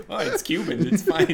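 laughing parody of a shrug-it-off attitude; mocking dismissive voice. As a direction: lampoon careless people shrugging it off, voice squeaking up high. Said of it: "They were just like".

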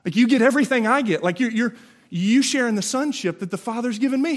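a thrilled brother sharing everything; bright rush of good news; excited Jesus voice. Acted.

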